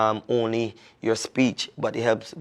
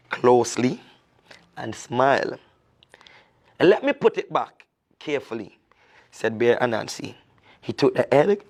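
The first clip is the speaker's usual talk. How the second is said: lively storyteller reading a folk tale; storyteller voice reading to children; story narration; 4 semitones higher than usual. A narrator's reading. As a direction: tell it like a children's story, expressive and clear. Reads the folk tale aloud.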